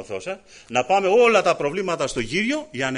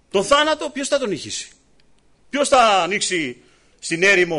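higher and louder, building preacher's cry; fiery preaching; 7 semitones higher, 5 dB louder than usual. Acted. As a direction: drive each item harder like a revival preacher. (ένθερμο κήρυγμα, δυνατά και ψηλά)

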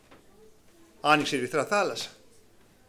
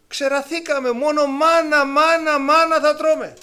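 higher, louder whiny complaint; grumbling people; 9 semitones higher, 7 dB louder, slower than usual. Retold or imitated. Israelites in the desert complaining about the manna, whining. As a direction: whine it like a fed-up crowd. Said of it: "Αυτός ο γογγισμός".

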